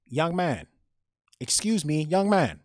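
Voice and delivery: put-on high, loud elderly Chinese woman's accent. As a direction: call out as an older Chinese woman.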